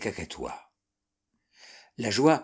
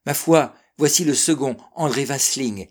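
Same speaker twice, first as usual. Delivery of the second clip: higher, louder announcement; excited exclamation; 4 semitones higher, 6 dB louder than usual. A narrator's reading. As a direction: call out as you recognize a sailor. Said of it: "s'écria Clairbeau".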